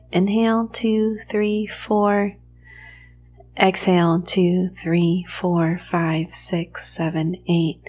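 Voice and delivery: measured count, sinking on the exhale; guided breath count. Shown as a demonstration. Teacher leading the four eight breath, guided breath count. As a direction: pace a slow breath for the listener, evenly spaced.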